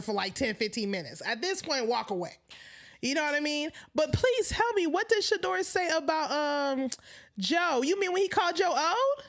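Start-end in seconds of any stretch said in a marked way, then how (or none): none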